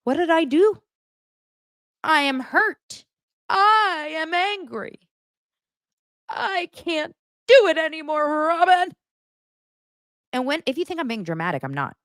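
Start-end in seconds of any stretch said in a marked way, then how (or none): 0.1-8.9 high, slow, whiny mock wail; mocking Cody. Vlogger mimicking Cody's wounded speech to Robin, high slow whine. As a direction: ham up his wounded pleading.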